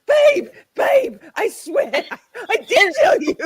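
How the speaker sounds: high, squealed pleading through giggles; imitated all-caps protest. Friend texting denial in all caps, high mock protest. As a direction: plead shrilly as the friend insisting, cracking up. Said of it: "in all caps".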